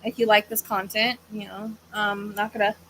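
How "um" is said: ordinary talk, nothing marked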